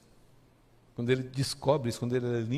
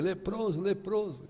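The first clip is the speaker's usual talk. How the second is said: calling out a warning; 7 semitones higher, slower than usual. Retold or imitated. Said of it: "tinha que dizer".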